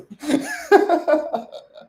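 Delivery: loud high burst of laughter. Real, not acted. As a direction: let out a high real laugh. Laughing at his own story.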